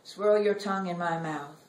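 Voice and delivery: measured, expressive poem reading with long pauses; poetry reading. Acted. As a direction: read it like a poet on stage, savoring each image. Reads her poem aloud.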